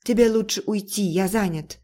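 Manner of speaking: ordinary delivery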